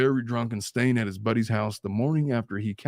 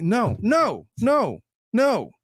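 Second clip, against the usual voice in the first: high, falling, separate repeated noes; insistent, punched denial; emphatic refusal; 7 semitones higher, 3 dB louder, much slower than usual. Real, not acted. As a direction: shut the excuse down firmly. Shoots down an imagined excuse.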